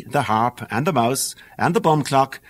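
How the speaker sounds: usual speaking voice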